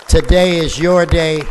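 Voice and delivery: loud, high emcee call; announcing to crowd. Real, not acted. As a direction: boom it out to the whole room. Emcee hypes honoree to crowd.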